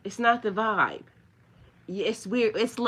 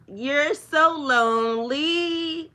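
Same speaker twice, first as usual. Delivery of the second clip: higher, slow, sobbing mock lament building to a high wail; hammed-up fake weeping that climbs much higher and louder; mock crying wail; 8 semitones higher, 7 dB louder, slower than usual. Taunting a blocked troll with a fake sobbing wail. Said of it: "In that tone and pitch".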